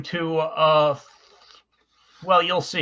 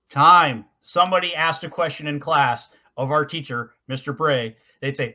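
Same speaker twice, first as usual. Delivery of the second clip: ordinary delivery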